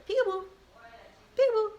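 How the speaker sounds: put-on high, short baby-game call; playful peekaboo. Coaxing a baby too young for peekaboo, high and playful.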